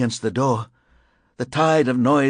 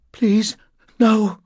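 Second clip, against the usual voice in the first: high, louder plea; frightened plea; 11 semitones higher, 6 dB louder than usual. A narrator's reading. Woman on the floor fends him off, frightened. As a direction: plead in alarm.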